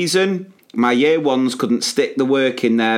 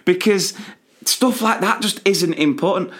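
high-pitched, exasperated venting; worked-up anger; 7 semitones higher than usual. Real, not acted. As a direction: vent it with a laugh breaking in first. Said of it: "It gets me so angry".

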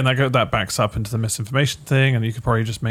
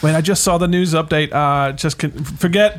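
mock frantic warning; 6 semitones higher, 4 dB louder, slower than usual. Mock skit continues.

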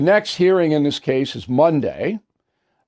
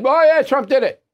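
higher, breezy mock of a judge shrugging it off; 9 semitones higher, 3 dB louder than usual. Host mocking the judge's ruling, breezy higher mock voice. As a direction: toss it off like a careless judge.

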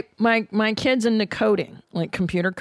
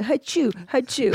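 mock sneezing; 4 semitones higher than usual. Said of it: "like you crybaby pussies".

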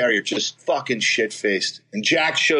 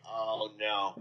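much softer, lower oh no; dread; 6 semitones lower, 13 dB quieter than usual. Retold or imitated. Narrator seeing the pilot approach, soft dread. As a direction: let it sink under your breath.

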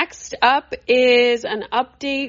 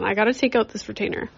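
usual speaking voice

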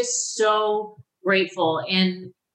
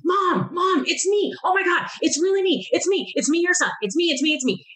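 high, urgent rush of joy; breathless-with-news retold cry of recognition, high-pitched; excited son; 9 semitones higher than usual. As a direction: imitate an overjoyed son calling his mom, pitched way up. Retold phone call from long-lost son.

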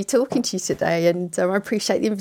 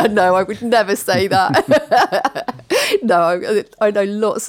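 slow, laughing denial, much louder; 3 semitones higher, 6 dB louder, slower than usual. Real, not acted. Guest laughing off the host's joke about lawyers. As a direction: laugh through it, protesting.

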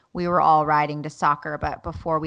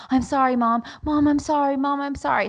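put-on higher child's voice, repeating an urgent apology; apologetic son; 8 semitones higher, 3 dB louder than usual. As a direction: mimic a little boy pleading for forgiveness. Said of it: "Levi's in the back seat".

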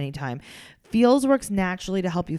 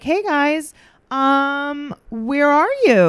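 higher, drawn-out, tentative quote; phone-call greeting; 8 semitones higher, 4 dB louder, much slower than usual. Retold or imitated. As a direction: imitate someone sweetly asking on a call. Voices Dawn phoning the couple.

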